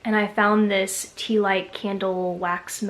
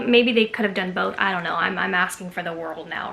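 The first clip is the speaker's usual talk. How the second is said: ordinary talk, nothing marked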